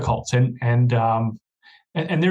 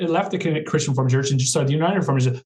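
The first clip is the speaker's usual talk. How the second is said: ordinary talk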